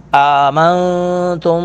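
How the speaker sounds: آمنتم with the meem held nasal and long; ghunna demo. Shown as a demonstration. Teacher demonstrating ghunna to class, slow nasal hold. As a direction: hum through the meem of آمنتم. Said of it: "میم ساکن پر غنہ کریں گے".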